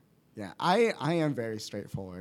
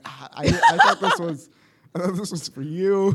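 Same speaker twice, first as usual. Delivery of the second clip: laughing, embarrassed protest, a bit louder; 7 semitones higher, 9 dB louder than usual. Retold or imitated. To Brittany asking for her pitch, laughing protest. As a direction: laugh your way through the excuse. Caught out by partner's pitch request.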